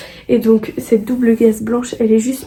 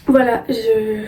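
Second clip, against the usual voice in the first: usual speaking voice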